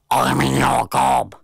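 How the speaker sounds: slow, gruff creature reply. A narrator's reading. Didgeridoo explaining itself to the boy, slow and gruff. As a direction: repeat it slowly in a gruff voice. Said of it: "gruff voice".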